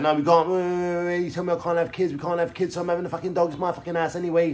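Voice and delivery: high-pitched mock protest; sustained high, nagging imitation of a partner; imitated arguing girlfriend. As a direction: rattle off an indignant comeback in her voice. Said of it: "And then she's like".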